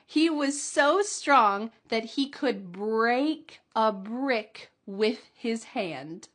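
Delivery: slow, emphatic teaching read; slow model sentence. Shown as a demonstration. Teacher reading the strength sentence slowly and clearly. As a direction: stretch it out so learners can copy. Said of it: "try to say it with me".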